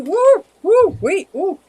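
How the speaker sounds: high, loud woo woo of delight; excited whoop. Real, not acted. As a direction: whoop at the incoming gift. Donation alert excites her.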